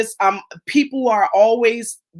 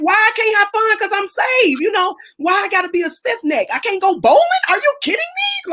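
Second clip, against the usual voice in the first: indignant disbelief; 11 semitones higher than usual. Real, not acted. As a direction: protest the rules, building to a peak. Indignant at church restrictions.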